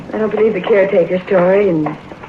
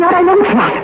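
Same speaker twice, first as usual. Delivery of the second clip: she is certain and terrified; panicked insistence; 10 semitones higher than usual. Acted. Wife insists, steps overhead.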